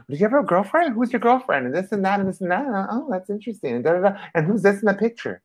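high, nosy interrogating voice; prying friend. Retold or imitated. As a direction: pepper him with questions in a high prying voice. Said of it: "kept questioning and questioning".

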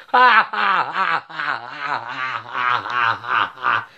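low, rhythmic ha-ha laugh; long cackle. Real, not acted. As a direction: laugh in a long descending run. Mocking laugh at the bad idea.

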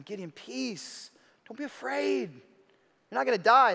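calm him gently, almost in his ear; tender reassurance. Acted. God gently calming frightened Gideon, hushed and tender.

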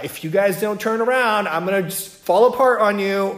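the ship warning the crew it will break apart; ship as a threatening character. Pretend. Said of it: "like a character that's, like, threatening to break up".

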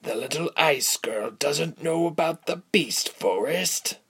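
high, mocking villain; derision. A narrator's reading. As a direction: scoff at the girl's ignorance. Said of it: "the man said with derision".

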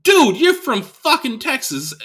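high, slow, disbelieving comeback; imitating his own incredulous comeback; incredulous retort. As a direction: say it like it's obvious. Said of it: "but it's like".

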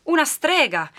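high, loud cry of alarm. A narrator's reading. Villagers recoiling from a supposed witch, alarmed cry. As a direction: cry it out in shock.

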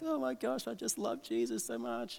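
high, small weepy gush; imitating tearful adoration, soft and high-pitched; mock tearful. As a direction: squeak it like an overcome devotee. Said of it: "tears down their face".

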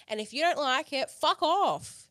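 loud, high, emphatic dismissal; indignant rant. Real, not acted. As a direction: build to an outraged send-off. Ranting about opinions on bodies.

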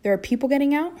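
high, loud disbelieving question; shocked. A narrator's reading. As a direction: blurt it out in alarm.

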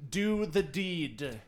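very slow, drawn-out cultist order; impatient command. Acted. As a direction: stretch each word as an ominous demand. Impatient cultist urging Cindy on.

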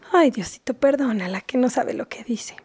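a quick hushed plea to God; murmured prayer. Acted. Mother prays under her breath for her daughter, whispering.

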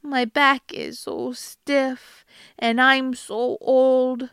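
put-on slow groaning old troll. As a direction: drag it out like a tired old hag. Troll feigns old age.

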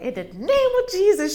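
loud, high prayer cry. Retold or imitated. Godmother praying over her, hand on her chest, loud. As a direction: call on Jesus with force. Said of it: "she was like".

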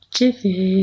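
high, stretched name call; drawn-out high summons; playful calling out. Pretend. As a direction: sing out the nickname up high. Calling the new nickname playfully.

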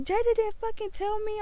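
high-pitched indignant phone rant; outraged retold complaint; retold angry cussing. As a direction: act out venting to mom, pitch way up. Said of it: "I'm cussing on the phone with my mom".

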